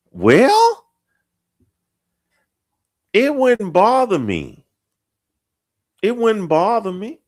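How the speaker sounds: very high mock falsetto; put-on falsetto. Host joking it wouldn't bother him, mock falsetto. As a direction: squeak it out far above your own voice.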